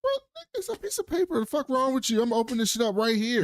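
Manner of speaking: high-pitched, mock disbelief; incredulous scoff. Common scoffs at a piece of paper, incredulous. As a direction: scoff in a high voice, rushing the end.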